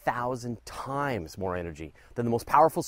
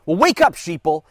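shouted high and loud; conspiracy shout; 6 semitones higher, 12 dB louder than usual. Pretend. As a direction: yell it like a conspiracy nut. Conspiracy-style outburst.

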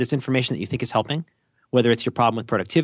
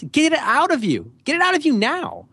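much higher, insistent command; urgent urging. Acted. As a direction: push it out in a high, emphatic voice. Urging listener to voice the thought.

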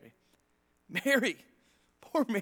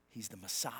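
awed whisper; 14 semitones lower, 15 dB quieter than usual. Acted. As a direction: whisper it in awe. Angel's message, awed reveal.

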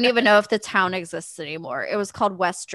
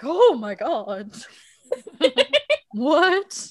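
shocked awe; 7 semitones higher, much slower than usual. Retold or imitated. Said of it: "I'm like".

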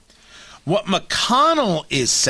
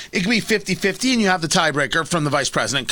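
ordinary talk

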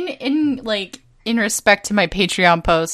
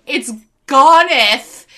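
mock archaic lament; 5 semitones higher, slower than usual. Mock-archaic lament over lost art.

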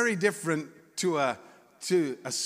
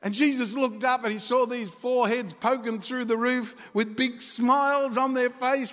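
usual speaking voice

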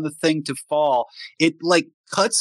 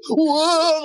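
high mock yelp of shock; a quick startled yelp, hammed up; retold scream; 12 semitones higher than usual. Noble reacting as the hologram ice cracks, mock scream. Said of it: "and screams".

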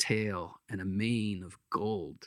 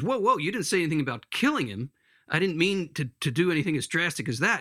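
higher, hurried backpedalling; alarmed protest; 6 semitones higher, faster than usual. Acted. Ghost objecting to the angel killing the lizard, alarmed protest. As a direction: protest in a startled rush.

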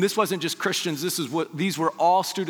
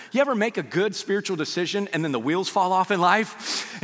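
ordinary talk

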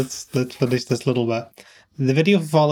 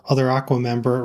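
ordinary talk

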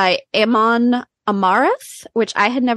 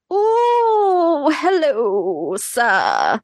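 flirty swoon; 5 semitones higher, much slower than usual. Retold or imitated. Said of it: "And I was like".

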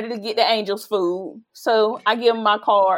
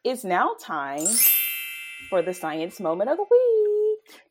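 segment announcement; 10 dB quieter than usual. Acted. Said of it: "show segment announcement".

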